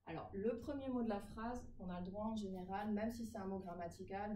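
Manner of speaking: normal talking voice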